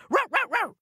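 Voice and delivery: very high yappy barking; dog bark. A narrator's reading. The dog barking at ogres, high yapping. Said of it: "The dog barked".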